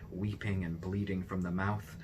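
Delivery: ordinary delivery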